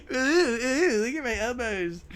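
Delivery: high mocking taunt; hammed-up playground teasing. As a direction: tease her with the elbows again. Replays Caleb's elbow taunt.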